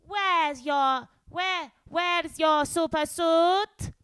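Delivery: loud, high cartoon-character demand; Edna. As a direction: call it out high, stretching the words. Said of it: "uh, The Incredibles".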